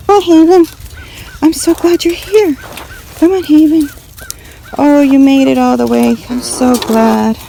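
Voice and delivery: high, loud happy cooing. Real, not acted. Welcoming her hen Haven, high and loud baby talk. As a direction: greet the hen with delight.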